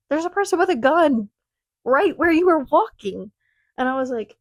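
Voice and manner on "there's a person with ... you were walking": higher and louder, alarmed; urgent warning. Retold or imitated. Professor warning student about a gunman, alarmed. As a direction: warn the student there's danger.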